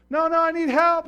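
high, loud frantic plea; panicked refusal. Acted. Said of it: "He goes".

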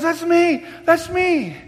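excited buddy. Retold or imitated. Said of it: "he goes".

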